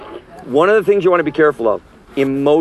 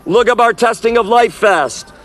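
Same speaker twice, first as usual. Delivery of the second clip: raised, higher preaching voice calling the crowd to repent; street preaching; 7 semitones higher, 3 dB louder, slower than usual. Acted. Street preacher warning the crowd away from the festival, preaching at full force. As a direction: preach it out to the passers-by, slowed on the key lines. Closing exhortation to the audience.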